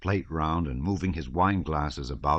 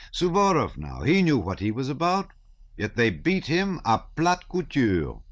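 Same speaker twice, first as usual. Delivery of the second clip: sardonic wit; 8 semitones higher, 4 dB louder than usual. A narrator's reading. Shinshin mocking the generals.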